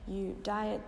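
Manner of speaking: ordinary talk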